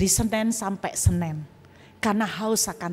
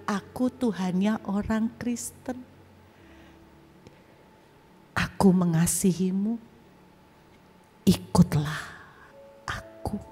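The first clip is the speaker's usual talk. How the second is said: voice of God; 2 semitones lower, 4 dB quieter than usual. Retold or imitated. Jesus inviting the youth to follow him, softer tender voice fading. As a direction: invite gently, let ikutlah aku fade away. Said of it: "Tuhan bilang".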